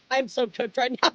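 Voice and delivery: ordinary talk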